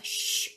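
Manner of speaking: 쉬 breathed as a long sh; whispered sh. Shown as a demonstration. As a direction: hush it: 쉬. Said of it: "둘이 같은 소리를 내". (쉬, 바람 소리로)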